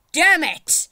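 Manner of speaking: high, loud explosive curse; frustrated shout. Real, not acted. Player misses the cannon, frustrated shout. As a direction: explode in annoyance. Fails again.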